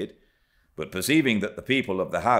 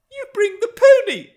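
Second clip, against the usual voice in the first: put-on high, loud girlish order; exclaiming; 12 dB louder than usual. A narrator's reading. Young Cathy turning to the woman, high loud exclamation. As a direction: cry out the command, pitched way up. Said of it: "she exclaimed".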